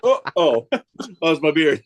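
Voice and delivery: half-laughing startled oh, oh; laughing surprise. Retold or imitated. Telling friends about mistaking his beard for a bug, laughing. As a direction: chuckle through a startled realization.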